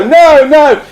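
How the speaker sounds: high, loud protest; acted high objection; crowd shouting no. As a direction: call it out like a dismayed audience. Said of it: "some go".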